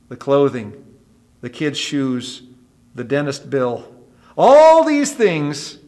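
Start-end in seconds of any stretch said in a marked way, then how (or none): 4.4-5.8 loud, high, stretched-out emphatic promise; Jesus. Acted. Jesus promising worried believers every need is covered, stern emphasis. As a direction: hold ALL long and high. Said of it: "Jesus turns to us and says sternly".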